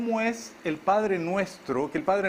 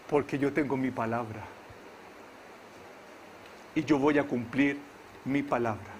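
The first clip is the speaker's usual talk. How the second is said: lower, softer, a light hint of God speaking; 7 semitones lower, 7 dB quieter than usual. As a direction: answer as a calm divine voice, low. God answering the preacher.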